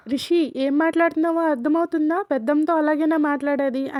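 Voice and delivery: lightly acted uncle shouting at nephew; retold shout. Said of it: "Ravindra అరుస్తాడు".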